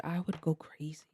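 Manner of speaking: whispered aside. Real, not acted. To the viewers about living in a hotel, a whispered aside.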